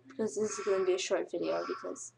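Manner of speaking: ordinary delivery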